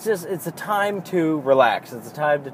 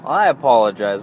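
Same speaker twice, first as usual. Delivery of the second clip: normal talking voice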